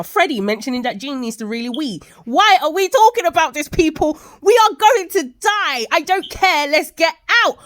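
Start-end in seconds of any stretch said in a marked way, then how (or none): 2.2-7.6 loud, high-pitched urgent rant; hammed-up panic at clueless people in danger; exasperated shouting. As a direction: shout at them to get moving. Urging characters to flee burning building.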